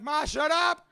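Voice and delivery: loud, high exasperated yell; yelling at mother. Retold or imitated. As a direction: retell snapping at your mother at full volume. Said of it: "I yelled at my mother".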